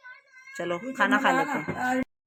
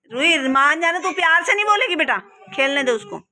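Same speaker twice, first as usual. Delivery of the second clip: raised-voice motherly telling-off; scolding; 6 semitones higher, 5 dB louder than usual. Real, not acted. Mother scolding fighting kids.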